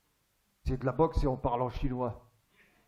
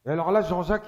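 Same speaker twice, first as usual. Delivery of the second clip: ordinary delivery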